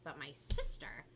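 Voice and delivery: ordinary delivery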